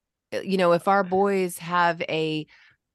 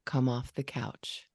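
lower, slower, quiet firm parent voice; calm daddy voice; 5 semitones lower, 6 dB quieter than usual. Shown as a demonstration. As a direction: talk down low and slow like a steady dad. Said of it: "a little bit deeper than you normally talk and slower".

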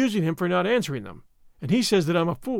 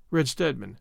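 plain reading voice

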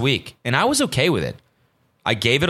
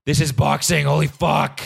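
drawn-out, higher-pitched awe; thrilled fan; 5 semitones higher, slower than usual. Retold or imitated. Podcaster recalling the fight from the edge of their seat, thrilled. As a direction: replay being blown away at ringside. Retelling excitement at watching the fight.

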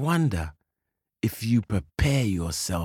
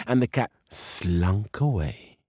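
usual speaking voice

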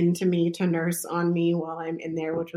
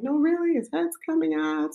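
excited insisting; 10 semitones higher than usual. Retold or imitated. Staff insisting baby is crowning.

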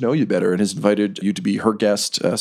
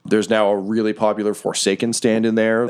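usual speaking voice

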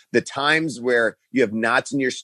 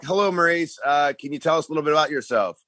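mock TV host; 6 semitones higher, faster than usual. Host voice in retold audition.

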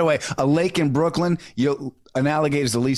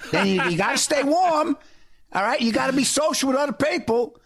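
high-pitched, hammed-up Brooklyn accent; 8 semitones higher than usual. Retelling Maslow as a Brooklyn guy, Brooklyn accent. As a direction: explain it like a Brooklyn wise guy, accent and all. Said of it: "The guy was from Brooklyn".

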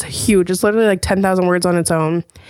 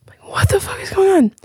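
high, whispered disbelief; baffled whisper; 10 semitones higher than usual. Retold or imitated. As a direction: hush it like you can't believe it. Baffled by mystery alarm.

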